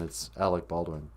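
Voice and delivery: usual speaking voice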